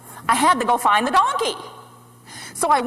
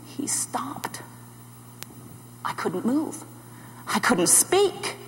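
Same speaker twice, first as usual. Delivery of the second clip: soft, halting near-whisper; overwhelmed, hushed and halting; awestruck hush; 8 dB quieter, slower than usual. Acted. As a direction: let the words barely come out. Struck still before Jesus.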